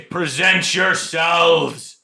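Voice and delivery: slow, loud barked command; drill sergeant. Pretend. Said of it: "like a drill sergeant".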